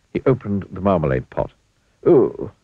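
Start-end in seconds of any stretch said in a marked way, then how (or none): 2.1-2.5 a surprised little "oh"; quick startled exclamation; exclaimed. A narrator's reading. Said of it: "he exclaimed".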